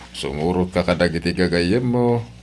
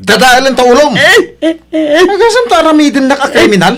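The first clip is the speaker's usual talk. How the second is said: much higher, louder shouting; furious yell; 12 dB louder than usual. Acted. Husband threatening his wife in a fight, yelling. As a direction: roar threats at her.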